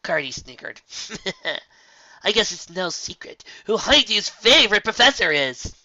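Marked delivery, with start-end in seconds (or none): 1.5-5.7 loud, high playful jab; teasing. Acted. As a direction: tease a friend. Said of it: "she remarked teasingly".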